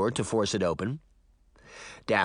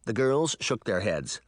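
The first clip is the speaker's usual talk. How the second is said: ordinary delivery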